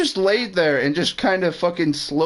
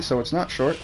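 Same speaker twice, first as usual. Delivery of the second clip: normal talking voice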